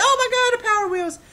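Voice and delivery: very high, loud mock squeal of joy; hammed-up high-pitched delight; ecstatic kid. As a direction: act out a child's shock at the dream present. Acting kid finding the present.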